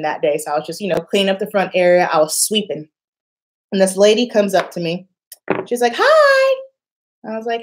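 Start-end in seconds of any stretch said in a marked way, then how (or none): 6.0-6.6 high, bright-eyed greeting from an eager mom; mom's sweet hello. Retold or imitated. Mother greeting the receptionist, high and sweet. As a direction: chirp it like a hopeful mother.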